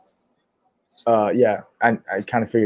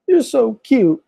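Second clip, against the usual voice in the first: high-pitched imitation of gushing over a puppy; mock cooing; 13 semitones higher, 3 dB louder, slower than usual. Imitating mom won over by the dog, high mock cooing. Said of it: "and then she goes".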